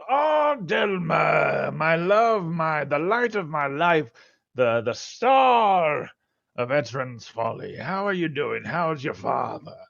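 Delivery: loud, high, gushing shopkeeper; overjoyed merchant greeting a friend; effusive merchant. Acted.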